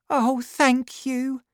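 high, slow thankful woman; grateful young woman. A narrator's reading.

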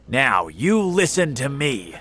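loud, commanding police officer; firm, loud officer's order; shouted order. A narrator's reading. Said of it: "shouted the police officer".

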